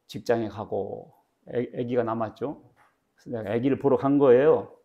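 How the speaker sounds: ordinary talk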